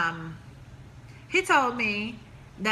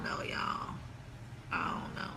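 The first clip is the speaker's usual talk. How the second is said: breathy near whisper, giving up; whispered trailing off; 14 dB quieter than usual. Real, not acted. To her viewers, puzzled about dating, whispering. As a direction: let it fade to a whisper.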